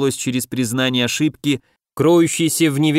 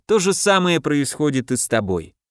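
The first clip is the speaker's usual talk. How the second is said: ordinary delivery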